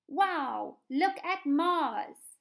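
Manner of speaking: high, loud, very slow amazement; marvelling, drawn-out demo of an exclamation; excited exclamation. Shown as a demonstration. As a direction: stretch out the wow as if Mars just appeared. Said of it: "there will be an exclamation mark".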